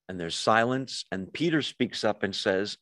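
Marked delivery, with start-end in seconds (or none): none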